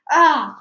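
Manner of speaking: loud high scream; girl screaming down a well; nosedive cry. Acted. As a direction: scream as she plunges. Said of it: "cried Ruth".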